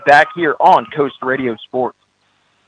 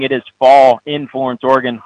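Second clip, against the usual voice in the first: ordinary talk, nothing marked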